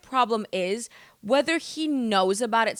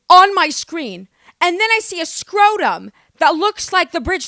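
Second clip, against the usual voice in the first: shocked outburst; 4 semitones higher, 8 dB louder than usual. Real, not acted. Shocked by the show.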